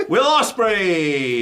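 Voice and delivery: loud, very slow topic call-out; drawn-out announcement. Real, not acted. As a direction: stretch the name out like an announcer. Calling out the topic again.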